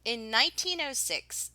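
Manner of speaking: usual speaking voice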